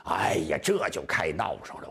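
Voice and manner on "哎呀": usual speaking voice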